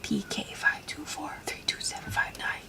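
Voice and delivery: whispering "P K five two four three two seven five nine" to herself. Real, not acted. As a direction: rattle off the number in a whisper. Reading long product name.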